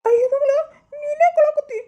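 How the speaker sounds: high, loud wailing sob; wailing cry. Acted. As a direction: wail as a mother losing her daughter. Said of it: "ನನಗೂ ಅಳು ಬರೋದೈತವ". (ಜೋರಾಗಿ ಗೋಳಾಡುತ್ತಾ ಅಳುವುದು)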